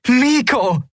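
high, joyful cry; jubilant. Acted. Endriel answering the boy on the recorder, jubilant cry. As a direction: cheer the name out. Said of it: "jubelte Endriel".

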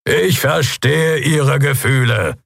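high, slow, projected voice; captain addressing crowd. A narrator's reading. Captain answers grieving citizens, calling out firmly. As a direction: call out firmly to a mob.

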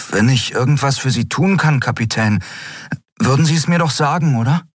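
a hint of a young crewman pleading; concerned Miko. A narrator's reading.

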